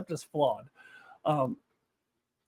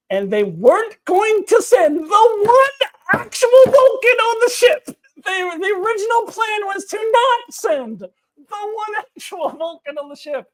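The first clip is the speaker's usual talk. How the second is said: high, loud disbelieving shout; screaming in disbelief; 8 dB louder than usual. Real, not acted. Reviewer screaming at the plan not to send the Vulcan. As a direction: scream it out in outrage. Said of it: "and I'm already screaming".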